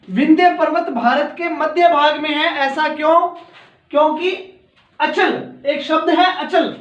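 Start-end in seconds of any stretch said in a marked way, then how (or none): none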